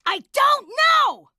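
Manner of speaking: sudden full-volume shout; high, exasperated yell. Acted. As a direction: blast it out far too loud. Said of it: "Could you please stop shouting".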